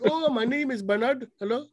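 lightly imitating an actor's Indian accent; mock Indian accent. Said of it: "he's like".

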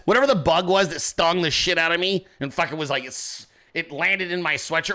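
ordinary delivery

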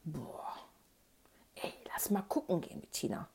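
very soft, hushed amazement; awed whisper. A narrator's reading. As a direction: whisper it like a secret plan. Awed friend secretly proposing an outing.

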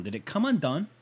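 ordinary talk, nothing marked

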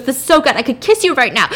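gushing retold joy; acted delight. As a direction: play overjoyed relief, lifting the voice. Character overjoyed help is coming.

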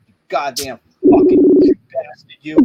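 high, loud screaming rage; furious yelling. Real, not acted. Raging at Facebook over the ding, loud screaming.

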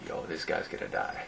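mock-ominous murmur among onlookers; retold hushed aside. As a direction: say it low to your buddies. Friends' hushed aside watching trouble brew.

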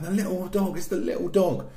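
high-pitched child imitation; mock little-girl wail; upset little sister. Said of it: "She kept going".

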